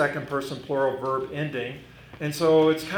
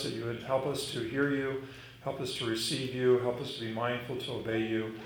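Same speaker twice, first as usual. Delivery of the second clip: hushed, reverent prayer that grows ever softer; 4 semitones lower, 4 dB quieter than usual. Acted. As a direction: pray humbly, fading out toward the amen. Opening prayer before the sermon.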